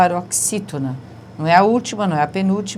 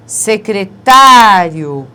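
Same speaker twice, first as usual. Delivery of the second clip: Secretário slow and much louder; emphatic reading; 10 dB louder, much slower than usual. Shown as a demonstration. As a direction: announce Secretário slowly and strongly. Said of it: "paroxítona terminada em ditongo". (Secretário devagar e forte)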